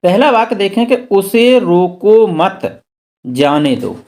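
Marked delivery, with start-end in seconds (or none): 1.1-4.0 slow up to मत, break, drop on जाने दो; pause after मत. Shown as a demonstration. Teacher showing a comma after मत, pause after मत. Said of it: "comma हमने मत के बाद लगाया था".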